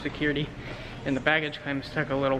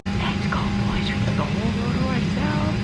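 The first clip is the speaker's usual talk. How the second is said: whispering; 8 semitones higher than usual. Real, not acted. Whispering on the quiet plane.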